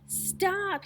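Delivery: a high sudden stop call; urgent child yelling stop; sharp high cry. Acted. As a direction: cry out to halt them. Ian stops monsters.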